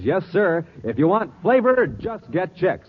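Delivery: usual speaking voice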